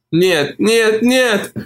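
high, lightly sobbing woman's voice; a hint of sobs, rising on each no; sobbing refusal. A narrator's reading. Olivia refuses to Mark, lightly sobbing. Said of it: "всхлипывала она".

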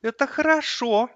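put-on high boy's voice, oddly satisfied; strangely calm child. A narrator's reading. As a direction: say it with eerie calm as a small boy. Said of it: "этого неожиданно спокойного голоса". (странно спокойный детский голос)

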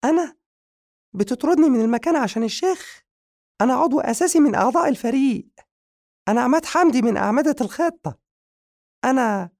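very high, loud, fast squeaky protest; indignant surprise. Acted. Madiha protesting to the magician about being sent away, high indignant squeal. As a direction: squeal in outraged disbelief. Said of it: "نظرت له مديحة بدهشة وهي تقول".

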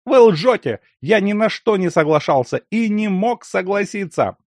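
high, loud accusing cry; furious shout. A narrator's reading. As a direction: shout him down in fury. Accuses of lying.

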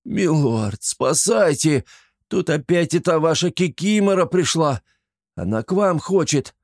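high, frightened cry for help; panicked plea. A narrator's reading. Flappy begs his lord for rescue, panicked. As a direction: call the lord for rescue in fear. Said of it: "завопил".

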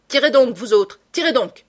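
loud, high command to fire; shouted order. Acted. Sholmès yells at his men to shoot, loud. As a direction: bark it at the men across the field.

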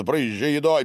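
usual speaking voice

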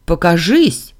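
loud, brief demand; cried out. A narrator's reading. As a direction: call out a challenge to the unseen. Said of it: "выкрикнула".

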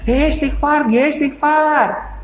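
high drawn-out urging; imitated deathbed prompting. Relative prompting the dying to seek forgiveness, imitated urging. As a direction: mimic someone coaxing, pitched high.

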